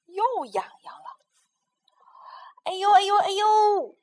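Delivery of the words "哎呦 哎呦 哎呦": high, loud hammed-up ouch; crying out in pain. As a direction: yelp like a bird faking a toothache. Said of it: "花袜子叫唤起来". (高声假装喊疼)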